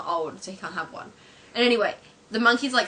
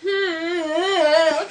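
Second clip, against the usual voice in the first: high, drawn-out monkey whine; imitated feeble monkey cry; loud put-on animal noise; 9 semitones higher, 7 dB louder than usual.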